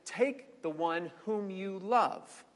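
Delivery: half speed, measured and solemn; God pressing Abraham slowly. Acted. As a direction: weigh every word. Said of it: "God said to him".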